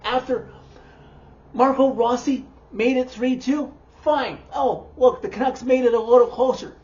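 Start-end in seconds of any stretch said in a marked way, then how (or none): none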